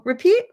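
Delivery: high rising "Repeat?" as a friendly prompt; teacher coaxing a student to say it again. Shown as a demonstration. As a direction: invite them to try again. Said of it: "ask them to repeat it".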